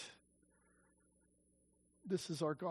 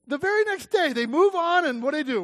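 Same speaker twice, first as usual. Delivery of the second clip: much higher and louder, thrilled; can't contain the news; excited preaching; 8 semitones higher, 8 dB louder than usual. Real, not acted. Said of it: "rising excitement".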